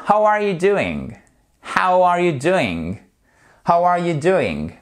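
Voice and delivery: "How are you doing" starting high, falling at the end; high start, then drop firmly on "doing"; falling intonation. Shown as a demonstration. Teacher modelling an open question, falling tune on "How are you doing". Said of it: "Du coup, intonation descendante".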